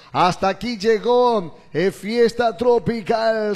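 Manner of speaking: loud, slow radio sign-off; announcer voice. Acted. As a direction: announce the show's end, slowly. Host announcing the show close. (voz de locutor de radio)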